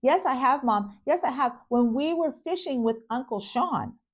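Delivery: a daughter insisting she's right; insistent little girl. Retold or imitated. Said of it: "And Emily goes".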